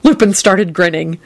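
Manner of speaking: ordinary delivery, nothing marked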